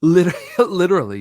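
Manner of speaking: loud, quick words through a real laugh. Real, not acted. Friend laughing loudly while agreeing on the podcast. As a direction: burst out laughing as you agree.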